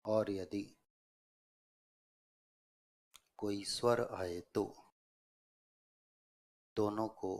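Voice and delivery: broken into short chunks with long pauses; dictation. Real, not acted. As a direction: dictate phrase by phrase, waiting for writers. Dictates a rule for writing. (श्रुतलेख, रुक-रुककर बोलते हुए)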